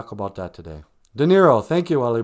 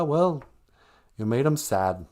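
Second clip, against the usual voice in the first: ordinary talk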